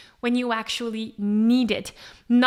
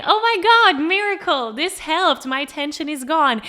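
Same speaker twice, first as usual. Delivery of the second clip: higher and louder, gushing with joy; thrilled relief; 4 semitones higher, 6 dB louder than usual. Pretend. Singer whose tension finally vanished, thrilled. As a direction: celebrate like a miracle happened.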